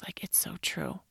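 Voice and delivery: whispered admission; whispering a wistful realization. Real, not acted. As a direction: hush it out, half to herself. Realizing the saying is true.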